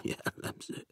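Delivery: a soft, breathy, scornful mutter; bitter scornful mutter. A narrator's reading. Guest scorns the red wine, bitter scornful mutter. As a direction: sneer it under your breath. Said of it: "Dünnyögte keserű lenéző gúnnyal".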